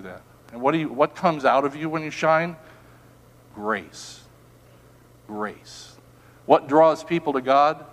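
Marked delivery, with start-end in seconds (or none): 3.5-6.0 very soft low Grace; hushed. Real, not acted. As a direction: breathe grace out, barely voiced. Pastor softly repeating grace.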